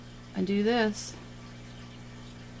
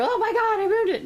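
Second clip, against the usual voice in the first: high, loud mock panic over a ruined painting; 14 semitones higher, 5 dB louder than usual. Teacher mimics a student panicking over a blob, high mock panic. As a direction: squeal it like a student who thinks it's ruined. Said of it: "You did a big blob".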